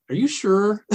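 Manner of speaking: a giggly, doubting check; laughing question. Retold or imitated.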